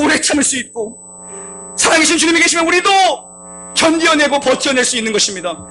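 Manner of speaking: rally the congregation to hold the cross; fervent shouting preacher; preaching at full force. Acted. Exhorting congregation to endure.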